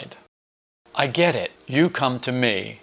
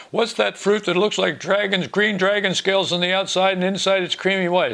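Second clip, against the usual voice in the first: high-pitched eager questioning voice; higher voice for a friend's query; imitated texter; 8 semitones higher than usual. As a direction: imitate someone puzzling aloud, pitched up. Voices a friend's puzzled text.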